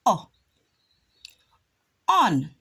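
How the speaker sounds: "O" as an open short o, "On" stressed; short o sound. Shown as a demonstration. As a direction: model "O" then "On" clearly. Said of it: "give the O sound".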